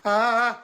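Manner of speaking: sudden high yelp of a fall; startled cry. Acted. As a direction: cry out as you slip. Child falls from furniture.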